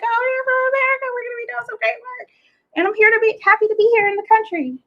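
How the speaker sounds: very high, rushed, gushing mock greeting; hammed-up squeaky eagerness, racing along; overexcited. Traveler imitating their own arrival speech to customs, overexcited. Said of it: "I'm overexcited".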